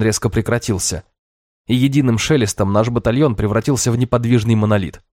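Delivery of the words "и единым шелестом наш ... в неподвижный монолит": usual speaking voice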